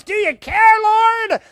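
high, loud cry to the Lord; shouted plea. Retold or imitated. Believer doubting that the Lord cares, shouted plea. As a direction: shout it up to heaven. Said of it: "shout out in our minds".

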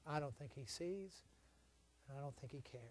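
very low, hushed admission; quiet doubt. Retold or imitated. Weary believer doubting God in long trouble, quiet doubt. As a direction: let it sink to a discouraged murmur.

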